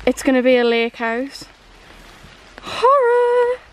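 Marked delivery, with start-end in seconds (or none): 2.6-3.7 drawn-out, high horror-film cry, then cackle; mock horror. Friend hamming up horror after the lake house remark, mock horror. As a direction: play up mock horror with a staccato high laugh.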